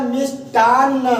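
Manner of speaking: मिष्टान्न slowly, doubled न held; careful pronunciation. Shown as a demonstration. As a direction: model मिष्टान्न for the pupils. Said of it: "आधा न के साथ आपका क्या होता है". (मिष्टान्न धीमे, न पर ज़ोर)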